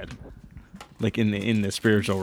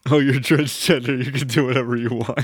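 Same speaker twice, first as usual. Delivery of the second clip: quoting a cartoon clip; 2 semitones higher, 4 dB louder than usual. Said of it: "it's like that Family Guy clip".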